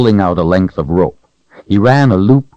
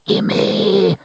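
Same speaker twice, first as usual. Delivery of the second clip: high, snatching creature cry; creature hiss; 13 semitones higher than usual. A narrator's reading. Creature hissing at Richard to grab the sword. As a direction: hiss it like a starving gollum-like creature. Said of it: "it hissed".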